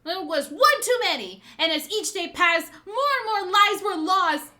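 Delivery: loud, proud, indignant speech; impassioned denunciation. Acted. Hiyori denouncing the shogun's dishonour, indignant. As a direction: drive each accusation home forcefully.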